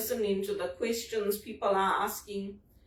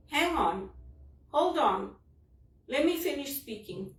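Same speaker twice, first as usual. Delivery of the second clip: higher, mildly mock impatient cut-off; imitating an interrupting agent; 4 semitones higher than usual. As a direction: lightly play an agent holding you off. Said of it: "That's how they talk".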